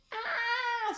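high falling shriek; mock scream. Sloth tumbling through the air, high mock scream. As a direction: squeal it like a falling lady. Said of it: "screamed Sloth again".

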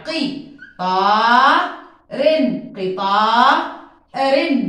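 قي طارن slow then قطارن joined; split then blended. Shown as a demonstration. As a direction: segment then blend the word. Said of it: "قي زي ما بقرأ بقطع". (تقطيع ثم دمج)

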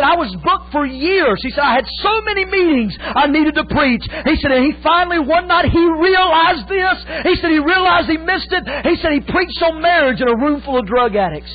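loud, high preacher's cry; full-force preaching. Acted. Preacher crying out Wilkerson's confession to the church. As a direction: push the story to a peak.

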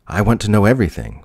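high, loud, fast and eager. Acted. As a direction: rush it out, hungry to know. Fermi eager for answers.

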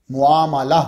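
muamala in full, long "aa" after "mu"; careful pronunciation of muamala. Shown as a demonstration. Preacher showing listeners the word's real pronunciation, careful muamala. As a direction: sound out every syllable of muamala. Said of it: "ये असल इसका प्रोनाउंसिए".

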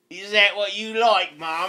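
needling put-on Aussie accent; Australian accent. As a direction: tease with a mock Australian accent. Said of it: "when I talk in my Australian accent".

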